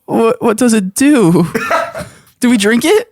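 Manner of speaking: high, worried question; nervous high voice. Acted. Character asks nervously.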